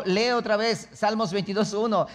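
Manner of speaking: normal talking voice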